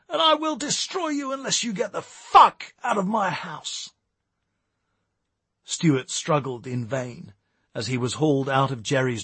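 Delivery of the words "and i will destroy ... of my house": furious, high-pitched order to leave; bellowing. A narrator's reading. Jerry throws Stewart out of her house, bellowing. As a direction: threaten him at a high pitch, full of rage. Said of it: "she bellowed".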